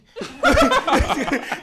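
bursts into a high, loud laugh; cracking up at the memory. Real, not acted. Teller cracks up retelling dad.

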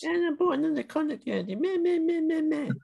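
high whiny mimic of his words; mocking blah blah. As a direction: parrot him back in a high sneering voice.

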